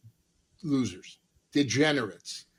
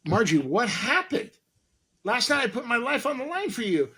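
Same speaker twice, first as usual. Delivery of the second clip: exasperated; 9 semitones higher than usual. Retold or imitated. Exasperated at Margie.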